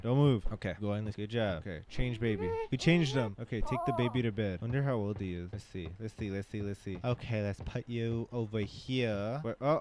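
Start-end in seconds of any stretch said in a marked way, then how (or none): none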